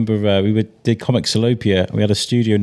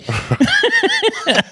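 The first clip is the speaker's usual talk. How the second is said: a short real laugh, much higher. Real, not acted. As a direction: laugh at your own story. S1 laughs at own anecdote.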